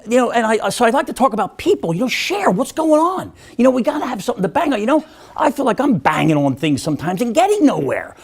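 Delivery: ordinary delivery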